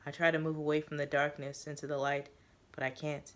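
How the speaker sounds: ordinary delivery, nothing marked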